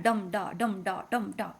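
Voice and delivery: regular hammer beat, "dum" struck and "da" let fall; hammering rhythm. Shown as a demonstration. Teacher likening the poem's meter to hammering, stressed "dum", weak "da". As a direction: pound out each "dum" like a blacksmith, "da" unstressed. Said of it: "blacksmith that is hammering".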